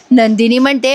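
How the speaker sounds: ordinary talk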